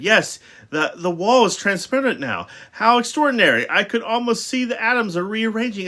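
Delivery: high, animated wonder; excited discovery. A narrator's reading. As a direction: marvel aloud like a scientist at a discovery. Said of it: "familiar sound of excitement and discovery".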